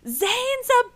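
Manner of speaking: very high, loud heckler's scream; imitated scream. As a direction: scream it like someone in the crowd. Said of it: "someone screams".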